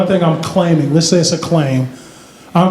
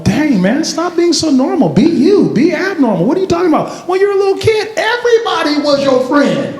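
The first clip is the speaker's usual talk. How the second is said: high, animated mock exasperation; exasperated plea; 8 semitones higher than usual. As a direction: plead with them in a pitched-up voice. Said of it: "I'm looking at them going".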